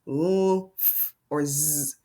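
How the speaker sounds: "l", "f", "z" each held as a pure sound; letter sounds. Shown as a demonstration. Teacher recapping the spelling rule, letter sounds held out. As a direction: stretch out the sounds "l", "f", "z", not letter names. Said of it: "if it ends with a".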